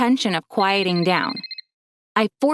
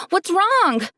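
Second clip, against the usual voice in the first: panicked yell; 10 semitones higher than usual. Acted. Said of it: "I sat up and yelled".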